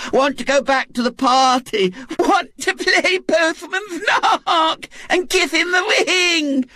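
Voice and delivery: put-on a spoilt child crying for her games; sobbing little girl. Violet Elizabeth wails to go back to the party, sobbing. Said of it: "sobbed Violet Elizabeth".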